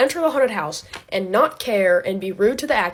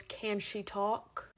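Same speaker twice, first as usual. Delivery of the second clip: very slow, soft question; slow condescending clerk; 10 dB quieter, much slower than usual. Retold or imitated. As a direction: drag it out like she's dense. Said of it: "The woman goes".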